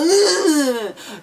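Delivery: high burst of laughter. Real, not acted. Laughs at his own impression.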